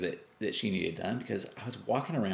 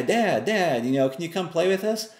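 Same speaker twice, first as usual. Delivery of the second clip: put-on higher, quick childlike begging; kids pleading; 9 semitones higher, 3 dB louder than usual. Kids asking Dad to play, higher and quick. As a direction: mimic the kids tugging at Dad. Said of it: "the kids say".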